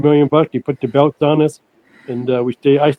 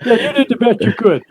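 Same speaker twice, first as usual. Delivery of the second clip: laughing through the words, higher; 9 semitones higher than usual. Real, not acted. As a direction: repeat the dig while cracking up. Repeating the line while laughing.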